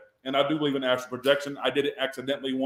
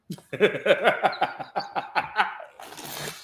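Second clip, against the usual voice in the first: villain laugh; 6 semitones higher than usual. Acted. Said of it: "I got a villain laugh".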